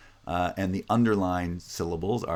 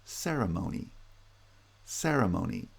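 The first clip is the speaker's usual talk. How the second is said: ceremony as CE-re-mo-ny, CE highest; primary stress first; 3 dB quieter than usual. Shown as a demonstration. Teacher showing primary stress on the first syllable, ceremony led by CE. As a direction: hit CE in ceremony, lighter stress on mo. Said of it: "with primary stress on the first syllable".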